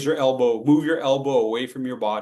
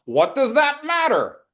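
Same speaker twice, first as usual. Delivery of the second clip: indignant student; 10 semitones higher, 4 dB louder than usual. Retold or imitated. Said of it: "mock student objection".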